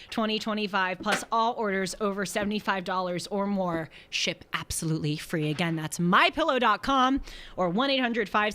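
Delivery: upbeat announcer ad read; lightly peppy ad voice. Acted. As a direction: sell it like a radio spot. Reads ad copy on air.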